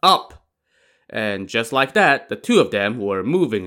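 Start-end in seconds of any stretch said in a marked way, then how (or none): 0.0-0.5 short, high, loud order; shouted command. A narrator's reading. Daizong takes hold of Li Kui, shouting. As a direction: shout it like a spell release.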